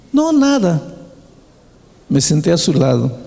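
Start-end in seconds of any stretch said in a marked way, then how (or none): 0.1-0.8 lightly voiced crying girl. Crying girl answers pastor.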